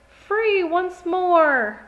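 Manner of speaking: high, loud and slow shout of freedom; triumphant cry. Acted. Beast escaping the girl, triumphant slow shout.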